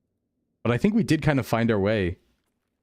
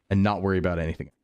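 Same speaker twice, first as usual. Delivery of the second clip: usual speaking voice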